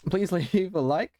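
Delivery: breaking into a laugh mid-ask; laughing through it. Real, not acted. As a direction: laugh as you say Please. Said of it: "losing my voice".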